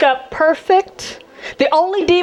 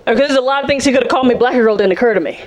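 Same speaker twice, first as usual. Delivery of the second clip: ordinary delivery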